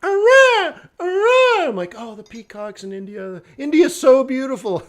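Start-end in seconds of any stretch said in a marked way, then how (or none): 0.0-1.7 very high, loud peacock screech; piercing mimicked bird call, repeated; imitated peacock cry. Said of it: "and then you hear the". 2.0-4.8 high-pitched mock rapture; retold tourist gushing, swelling louder; gushing delight. Retold gushing over the peacocks.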